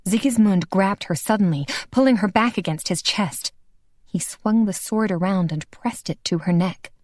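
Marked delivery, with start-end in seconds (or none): none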